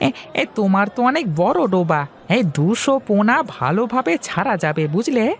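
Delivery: put-on high, eager young man's voice; young fish seller Hari. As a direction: talk up to your elder brightly. Hari advises Mahesh. (উঁচু গলায় উৎসুক তরুণ হরি)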